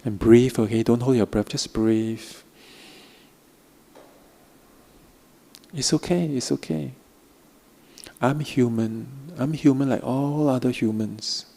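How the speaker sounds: slow, soft guiding voice with long pauses; guided meditation. Acted. Teacher guiding students through a practice, soft meditation voice. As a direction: ease the listeners into softness, gently.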